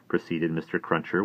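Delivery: usual speaking voice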